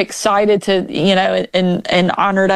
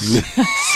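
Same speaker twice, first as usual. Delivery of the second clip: laugh. Real, not acted. Laughs at son's joke.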